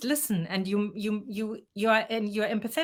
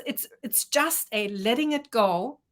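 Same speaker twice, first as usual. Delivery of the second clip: usual speaking voice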